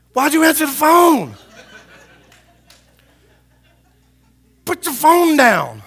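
high, very loud outraged yell; retold angry shout. Pastor scolding someone on the phone at a funeral, yelling. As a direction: explode at them in exasperation.

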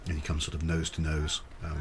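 ordinary talk, nothing marked

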